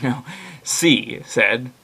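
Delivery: usual speaking voice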